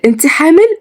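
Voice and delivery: very high, excited rising question; thrilled high-pitched girl asking; amazed delight. Acted. Girl learns of the pregnancy.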